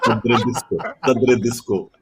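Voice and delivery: put-on "Dadre Disco" with the r and d swapped, sung like a little kid; imitating a toddler saying "Dadre Disco"; child's mispronunciation. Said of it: "He couldn't say Darde Disco".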